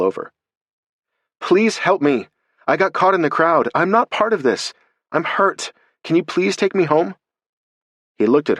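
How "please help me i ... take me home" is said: high, pleading cry for help; desperate plea. A narrator's reading. Alex begging the officer for help, high and desperate. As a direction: beg the officer to let her go.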